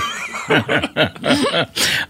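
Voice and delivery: bursting into helpless laughter. Real, not acted. Bursts out laughing reading letter.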